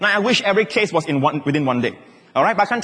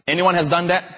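normal talking voice

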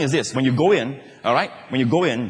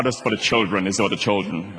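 prim spouse; 9 semitones lower, 3 dB quieter than usual. Acted. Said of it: "I don't call her darling anymore".

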